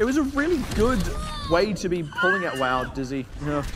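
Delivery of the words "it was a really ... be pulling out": excited. Real, not acted. Big play, caster shouts.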